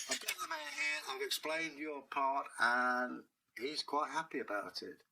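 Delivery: plain reading voice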